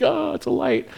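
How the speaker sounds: high, light awed squeal; imitated excited kids. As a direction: mimic kids thrilled by a glimpse of light.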